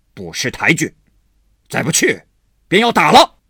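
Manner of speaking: rising to a loud, high threatening bark; angry threat. A narrator's reading. Husband threatening the maid, rising to a shout. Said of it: "忙又赶来骂香菱".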